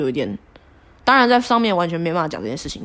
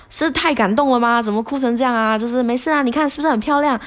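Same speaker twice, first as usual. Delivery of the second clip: higher, upbeat tour-guide coach; coach cheering up; 6 semitones higher than usual. Retold or imitated. Coach pointing out the scenery, higher upbeat. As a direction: sell her the view cheerfully. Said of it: "他就说".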